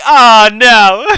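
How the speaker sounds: loud, high mock wail, laughing; exaggerated drawn-out cry of alarm; mock dismay. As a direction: imitate her dismay, hammed up. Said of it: "And then she's like".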